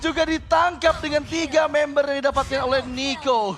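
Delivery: loud, high hype play-by-play; fighting game announcer. Real, not acted. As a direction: scream the teamfight call. Live play-by-play of teamfight. (komentator pertarungan yang heboh)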